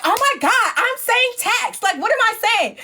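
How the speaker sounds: high-pitched exasperation; irritated. Retold or imitated. Host fed up with producers' corrections, irritated. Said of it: "now I'm getting irritated".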